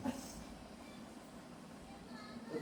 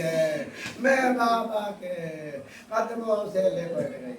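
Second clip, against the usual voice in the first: grieving lament; 6 semitones lower, slower than usual. Acted. Sakina's lament, voice breaking.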